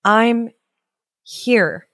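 put-on lightly muffled, halting child character; muffled answer. A narrator's reading. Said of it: "a muffled voice answered".

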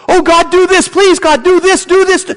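high, pleading mock prayer; mock frantic pleading. Preacher mocking pagans crying out, high mock pleading. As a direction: parody a desperate pagan begging God.

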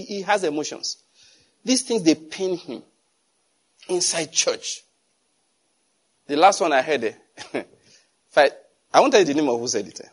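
normal talking voice

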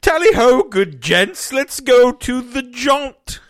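high, loud, slow hammed-up toff; mock posh Brit. Cohost jokingly announcing the Stephen King story, posh and hammy. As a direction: trumpet it like a pompous gentleman.

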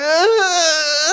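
high, loud mock sobs; exaggerated child's wail; imitated intense crying. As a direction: sob like an overwhelmed little girl. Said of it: "intense crying".

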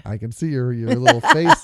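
affectionate mock teasing. Real, not acted. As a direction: poke fun at her face. Teasing wife about her expression.

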